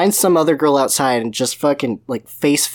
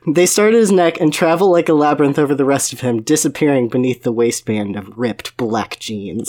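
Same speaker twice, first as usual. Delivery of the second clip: dramatic romance reading; 3 dB louder than usual. Pretend. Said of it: "reading steamy passage dramatically".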